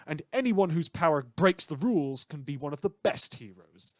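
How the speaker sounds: ordinary talk, nothing marked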